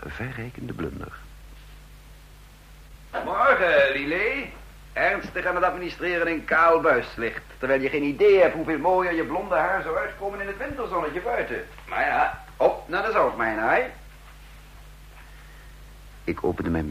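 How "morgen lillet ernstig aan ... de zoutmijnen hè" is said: higher, cheerful boss teasing his secretary; breezy flirty greeting. Acted. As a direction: charm her with light teasing.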